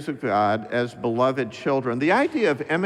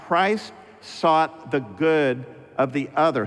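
ordinary delivery

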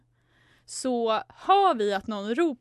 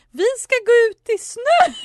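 loud, high-pitched staged announcement; said loudly; 12 semitones higher, 10 dB louder than usual. Retold or imitated. Friends announcing their snow cover story for others to overhear, loud. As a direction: call it out extra loudly for others to hear. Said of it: "sa högt".

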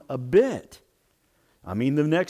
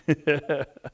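short laugh. Real, not acted. Laugh after the punchline.

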